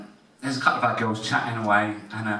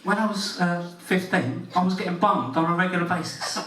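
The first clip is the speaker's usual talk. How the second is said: imitating the schoolgirl, pitched up; mocking girl voice; 6 semitones higher than usual. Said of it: "They've all got the same voice".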